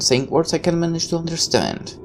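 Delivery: normal talking voice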